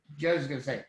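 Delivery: usual speaking voice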